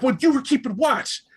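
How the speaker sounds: high, shocked retort; indignant disbelief. Acted. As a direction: throw the blame back. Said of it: "They're like".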